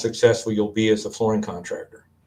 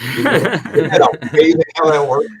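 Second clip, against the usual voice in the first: amused laugh through words; 5 semitones higher, 6 dB louder than usual. Real, not acted. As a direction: laugh while marveling. Laughing at ironic point.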